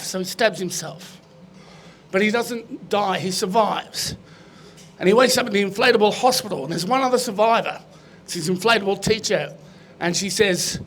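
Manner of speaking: ordinary talk, nothing marked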